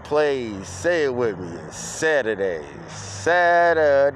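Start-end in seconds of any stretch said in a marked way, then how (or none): 2.9-4.1 louder, higher, stretched-out call; rallying call to the crowd; drawn-out cheer. Real, not acted. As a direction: sing out the day, long and loud. Repeats the day as a cheer.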